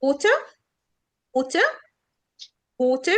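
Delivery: water with an O sound and the R dropped; water with the R silent; British water. Shown as a demonstration. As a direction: say water as wootuh. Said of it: "ال A هنا جاية O".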